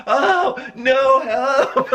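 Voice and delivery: very high, laughing cry for help; mock panicked cry. Boy getting wet under her, very high laughing cry. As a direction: squeal like a soaked kid. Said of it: "I'm like".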